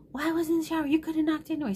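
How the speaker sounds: high, loud mock telling-off; retold angry scolding. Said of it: "he's mad that this happened".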